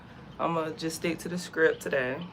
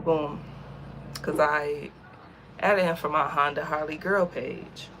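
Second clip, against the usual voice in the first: usual speaking voice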